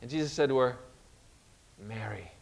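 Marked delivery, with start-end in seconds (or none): 1.8-2.3 very soft, low single word; quiet, intimate address; gentle naming. Acted. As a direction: say the name hushed and low, to be recognized. Jesus gently naming Mary at tomb.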